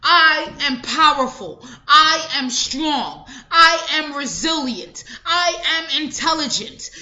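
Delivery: each I stressed, falling firmly at the end; firm affirmations. Shown as a demonstration. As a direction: declare every I am as fact. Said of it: "You start speaking like this".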